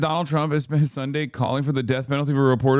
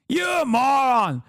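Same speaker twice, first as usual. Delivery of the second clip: laughing, then shouting the insult higher; mock shouted insult; 7 semitones higher, slower than usual. Host yells at the Eve Barlow impression, mock shout. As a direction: yell it back, half-laughing.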